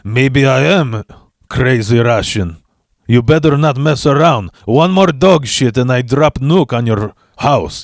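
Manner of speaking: put-on lightly done crazy Russian accent; a touch of a Russian accent, playful menace; mock Russian accent. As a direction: threaten her in a hammed-up Russian accent. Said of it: "profiled as a Russian".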